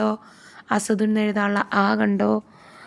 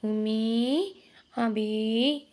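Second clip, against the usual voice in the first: lamb calling parents; much slower than usual. Acted. Lost lamb answers the lion, calling for its parents. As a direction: cry out for mum and dad like a lost lamb. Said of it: "ഇങ്ങനെ വിളിച്ചു അല്ലേ".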